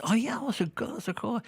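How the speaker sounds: imitating his wife's delight; lightly voiced happy wife; pleased wife. Wife liking sneakers, voiced high.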